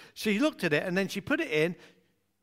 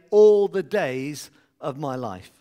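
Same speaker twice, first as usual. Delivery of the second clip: All the days of my life. said slow and louder as the cue; leading a response; 3 semitones lower, 8 dB louder, slower than usual. Shown as a demonstration. Preacher cueing the congregation's response line, loud and slow. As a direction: call out the response line for them. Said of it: "When I pause, you say".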